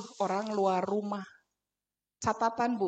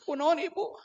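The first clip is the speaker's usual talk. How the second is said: ordinary talk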